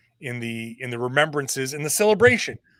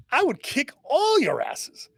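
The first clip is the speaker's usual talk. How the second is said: Bill Russell impression; 11 semitones higher than usual. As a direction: squeak it out like a cocky old legend. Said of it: "perfect Bill Russell standing there".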